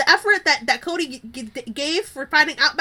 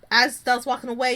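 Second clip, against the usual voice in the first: ordinary talk, nothing marked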